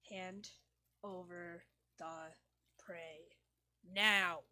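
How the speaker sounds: slow build to a loud snap on the last word; threatening demand. Acted. The cat giving its last warning, slow threat then loud order. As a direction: demand it like a predator.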